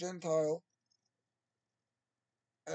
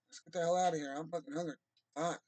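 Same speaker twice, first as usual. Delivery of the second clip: ordinary delivery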